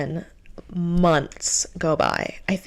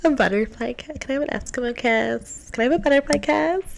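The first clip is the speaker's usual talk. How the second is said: higher, whiny mock request; mock pleading; 7 semitones higher than usual. Mocking a date begging for kisses, whiny. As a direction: ham up a silly plea.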